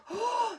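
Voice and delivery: sharp high gasp of fright; dramatic gasp. Acted. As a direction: gasp as if startled. Troll appears.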